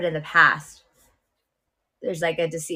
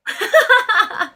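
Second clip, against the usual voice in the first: loud real laugh; 8 dB louder than usual. Real, not acted. Reacts to his blunt remark.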